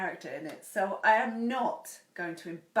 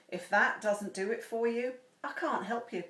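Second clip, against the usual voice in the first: ordinary talk, nothing marked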